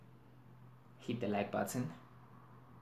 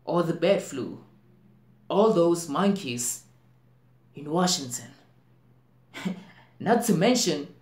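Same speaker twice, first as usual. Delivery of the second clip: raised voice, loud and high; alarmed outburst; 8 semitones higher, 10 dB louder than usual. Acted. Actor listing near-miss outbreaks, alarmed outburst. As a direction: build into an acted, agitated cry.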